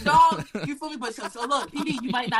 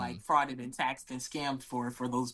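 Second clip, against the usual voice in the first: quiet aside; 10 semitones lower, 6 dB quieter than usual. Real, not acted. Joking disclaimer about fraud.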